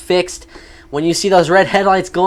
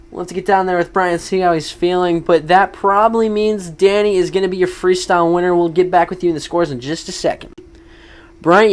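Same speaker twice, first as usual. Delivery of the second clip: normal talking voice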